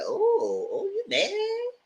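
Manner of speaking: high teasing "you mad?"; mocking taunt. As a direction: taunt him in a high mock voice. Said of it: "I said".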